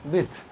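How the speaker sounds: विड्थ with the D left silent. Shown as a demonstration. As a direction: say विड्थ without sounding the D. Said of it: "डी silent होता है". (विड्थ में डी silent)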